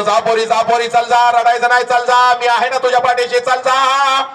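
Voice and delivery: high, rising, pushing cheer over tears; father brightly sending his daughter away; father sending her off. Retold or imitated. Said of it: "हसत हसत तुला म्हणतो". (हसत निरोप देणारा बाप)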